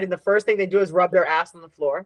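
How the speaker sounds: usual speaking voice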